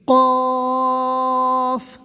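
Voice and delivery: قاف with a heavy throat q, the long a held very slowly; slow full-mouthed قاف; drawn-out qaaf. Shown as a demonstration. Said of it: "جیسے".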